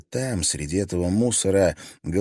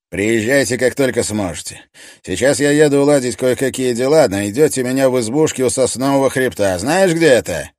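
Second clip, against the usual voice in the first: commanding; 5 semitones higher, 7 dB louder than usual. Acted. Stoffe giving orders.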